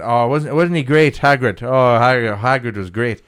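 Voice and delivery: put-on light Northern Irish accent, drunk teen; a hint of a drunk Northern Irish lad. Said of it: "it was a Northern Irish accent".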